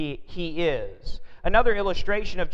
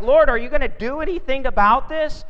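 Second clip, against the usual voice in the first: higher, louder and fast, like panicked sailors; panicked plea; 8 semitones higher, 6 dB louder, faster than usual. Retold or imitated. Disciples waking Jesus in the storm, panicked and fast. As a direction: blurt it out in a nervous panic. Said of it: "nervous panic".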